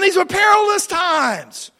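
high, loud, drawn-out proclamation; full-force preaching. Acted. Preacher reading on to the church, full-force proclamation. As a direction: cry out the scripture slowly.

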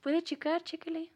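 high, bright vendor call; hyped fairground barker. Pretend. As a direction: hawk it to passing customers. Market vendor calling customers.

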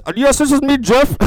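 high, loud silly caller voice; caller Jeff. Acted. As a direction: pipe up loudly as the returning caller. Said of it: "Who is this?".

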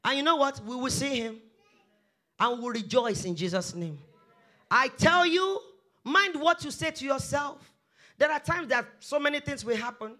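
ordinary talk, nothing marked

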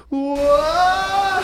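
mock movie gasp. Movie water breaking.

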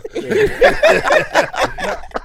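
shrieking laughter; loud high laugh. Real, not acted. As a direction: burst out laughing. Big laugh.

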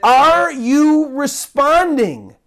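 slow, weighty appeal rising on are you responding; deliberate pleading question; slow urgent question. Acted. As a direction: press the question home, slowly.

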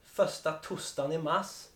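"första tostan i mars" slowly, swallowing the r sounds; dialect phrase. Shown as a demonstration. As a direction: stretch "första tostan i mars" with dropped r's. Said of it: "vilka är det som kör med den här".

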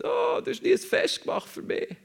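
very high, whining complaint; whiny older brother. Retold or imitated. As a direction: complain like a sulking big brother. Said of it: "Der ältere Bruder kommt und sagt". (sehr hoch und weinerlich)

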